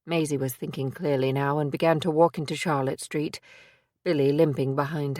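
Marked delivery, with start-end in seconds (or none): none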